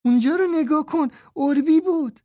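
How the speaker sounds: put-on high girl's voice calling out; shout. A narrator's reading. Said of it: "فریاد زد".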